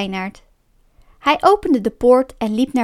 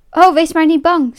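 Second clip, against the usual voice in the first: quick, louder brush-off with raised pitch; hurried; 4 semitones higher, 5 dB louder than usual. A narrator's reading. Bear dismisses the fox's warning, hurried. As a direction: jump in promptly to wave it away. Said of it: "zei Bruin snel".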